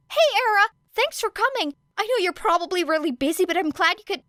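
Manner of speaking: high, bright-eyed rush of a greeting; eager Midoriya voice. Acted. Midoriya opening the door to Era, eager high greeting. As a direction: burst out happy to see her.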